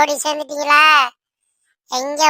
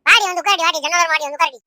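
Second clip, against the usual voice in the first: usual speaking voice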